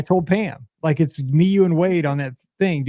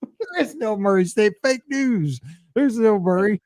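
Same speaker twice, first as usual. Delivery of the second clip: mock indignation; 6 semitones higher than usual. Joking denial that college exists.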